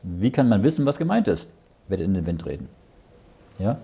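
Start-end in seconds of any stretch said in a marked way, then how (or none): none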